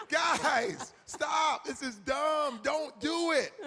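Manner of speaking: high, loud mock woman shouting stop; mock scolding woman. Lady scolding two guys about jumping, high loud mock shout. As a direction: shout them down like an exasperated lady.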